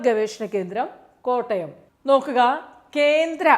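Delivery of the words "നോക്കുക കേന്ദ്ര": emphatic call. Shown as a demonstration. Tutor flags central institutes.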